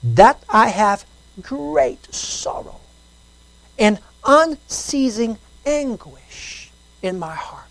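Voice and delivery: slow, soft, grave reading; hushed solemn verse; slow soft scripture reading. Acted. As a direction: let the anguish sit in each word. Reads ESV slowly.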